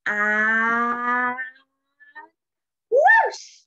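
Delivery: long rising 'and', then a high loud whoosh; building take-off. A narrator's reading. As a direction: stretch the suspense, then burst out. Broomstick takes off in story.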